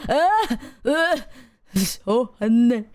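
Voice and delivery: slow, slurred "so honey"; mock mouthful. Copying his own honey shot, slow and slurred. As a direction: groan it through a mouthful. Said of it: "I go".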